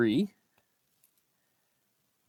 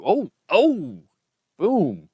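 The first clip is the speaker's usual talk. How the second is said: much higher and louder, thrilled; excited outburst; 10 semitones higher, 12 dB louder, much slower than usual. Real, not acted. Coin hunter spotting a rare coin, thrilled high shout. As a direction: shout it out like a jackpot.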